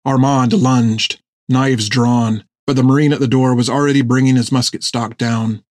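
usual speaking voice

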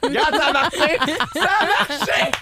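high, laughing exclamation. Real, not acted. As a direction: shout it while cracking up. Bursts out laughing after laughing yoga.

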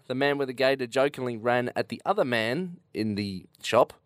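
usual speaking voice

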